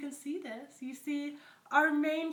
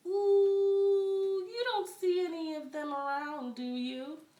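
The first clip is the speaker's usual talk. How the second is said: high, louder, lips pursed small; puckered tiny voice; 7 semitones higher, 4 dB louder than usual. Acted. Frog nervously fibs to the alligator, tiny puckered voice. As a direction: squeeze the words through a tiny puckered mouth. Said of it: "puckered his lips and made his mouth as small as possible".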